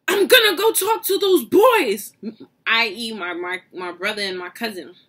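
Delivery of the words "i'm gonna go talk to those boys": high, loud furious threat; grandma storming off, shouting high; mad grandma. Retold or imitated. Grandma vowing to confront the boys, high angry shout. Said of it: "she gets mad".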